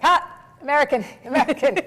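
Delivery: sudden loud, high-pitched jeer; mocking British critic. British listeners catching an actor's accent slip, mock accusing. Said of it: "the British would be like".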